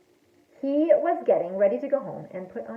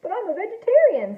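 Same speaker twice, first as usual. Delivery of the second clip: lightly voiced shark, pitched higher; protesting shark; 8 semitones higher than usual. A narrator's reading. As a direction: plead innocence as the misunderstood shark. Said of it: "says the shark".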